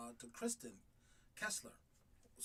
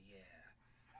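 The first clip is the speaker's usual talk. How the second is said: breathy whispered yeah; 9 semitones lower, 20 dB quieter than usual. Retold or imitated. Husband retelling his return, whispering. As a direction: hush it like you're sneaking back in.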